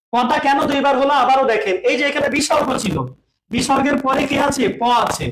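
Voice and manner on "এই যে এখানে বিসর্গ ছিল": ordinary talk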